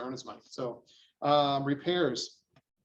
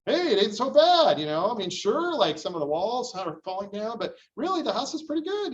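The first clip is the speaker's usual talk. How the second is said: high, loud breezy nonchalance; unbothered client; 7 semitones higher, 6 dB louder than usual. Retold or imitated. Prepared buyer shrugging off the inspection report, breezy and carefree. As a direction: shrug it off cheerfully, pitched way up and loud. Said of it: "they're like".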